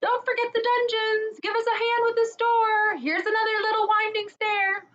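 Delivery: squeaky excited calls through the castle; crying out. Acted. Freed creatures calling out while searching, high excited cries. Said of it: "everyone's voices crying out at once".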